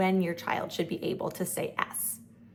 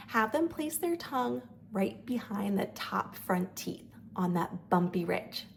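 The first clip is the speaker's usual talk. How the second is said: ordinary talk, nothing marked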